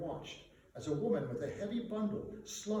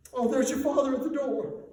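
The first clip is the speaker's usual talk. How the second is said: higher, louder, forced cheer; sudden brightening; 11 semitones higher, 11 dB louder than usual. Acted. Mother seeing Bob arrive, higher louder voice.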